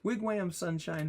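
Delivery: high-pitched, incredulous reading of an odd phrase; baffled mock recital. Shown as a demonstration. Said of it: "who talks like that".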